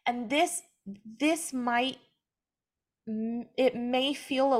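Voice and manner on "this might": ordinary talk, nothing marked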